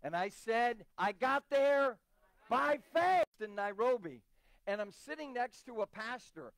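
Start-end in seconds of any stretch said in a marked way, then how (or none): 1.0-3.2 loud, high, slow proclamation; emphatic preaching. Retold or imitated. Preacher answering how he got there, emphatic preaching. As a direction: proclaim it to the congregation, drawing out each word.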